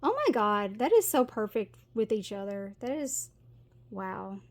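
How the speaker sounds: usual speaking voice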